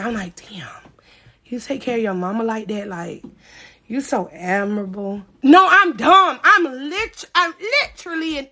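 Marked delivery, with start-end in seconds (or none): 0.4-5.2 soft unvoiced whisper of fake sympathy; mock admiration. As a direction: breathe it out in naive awe.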